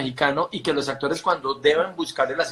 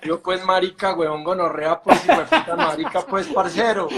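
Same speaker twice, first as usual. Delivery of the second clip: fake Colombian accent; 2 semitones higher than usual. Spanish actor fakes Colombian slang, laughing and mocking. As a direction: ham up a Spaniard faking Colombian. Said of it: "yo sé hablar colombiano".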